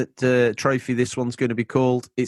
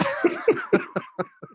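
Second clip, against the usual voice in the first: high squeaky laugh. Acted. Laugh after Mario impression.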